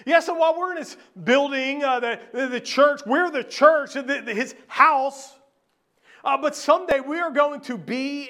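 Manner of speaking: high, loud preaching to the congregation; full-force preaching. Acted. Pastor proclaiming to the congregation, loud and lifted. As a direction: preach it out with conviction.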